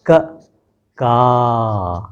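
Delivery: क short then का long and drawn out; क clipped, का held open from the throat; short and long vowel demo. Shown as a demonstration. Teacher contrasts the vowels for pupils, short then long. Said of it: "कंठ से आ रही है".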